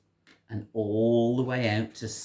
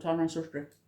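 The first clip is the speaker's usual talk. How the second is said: ordinary delivery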